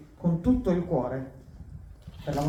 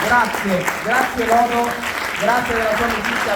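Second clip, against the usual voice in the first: high, loud thanks over applause; 6 semitones higher, 8 dB louder than usual. Real, not acted. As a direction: call out thanks to the crowd.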